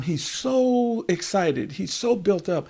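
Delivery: high-pitched, excited storytelling; built-up excitement. A narrator's reading. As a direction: wind up with the overexcited king. Storyteller mirrors the king's excitement.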